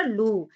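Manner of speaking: "लू" with a long, held u; long vowel. Shown as a demonstration. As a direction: stretch out the vowel of "लू". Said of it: "लंबे स्वर में बोला जाता है".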